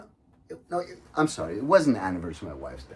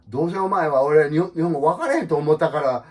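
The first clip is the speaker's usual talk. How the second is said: rough Kansai; 5 semitones higher, 9 dB louder than usual. Retold or imitated. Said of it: "in dirty Kansai".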